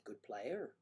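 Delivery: soft attempted Irish upturn; quiet go at an Irish lilt. Shown as a demonstration. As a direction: end "player" on a rise, Irish-style. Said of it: "goes up at the end".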